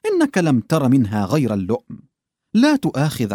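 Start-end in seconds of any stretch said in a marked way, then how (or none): none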